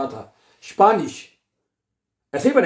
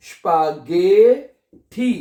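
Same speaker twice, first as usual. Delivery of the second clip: स्पागेठी very slowly, गे stretched and lifted; sounding out; 4 dB louder, much slower than usual. Shown as a demonstration. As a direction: teach स्पागेठी syllable by syllable. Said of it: "क्या बनेगा".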